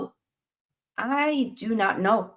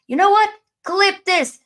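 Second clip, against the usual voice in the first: much higher and louder, shouting to chat; hyped call-out; 12 semitones higher, 8 dB louder than usual. Real, not acted. Streamer telling chat to clip his prediction, hyped shout. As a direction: yell it so the clip lands.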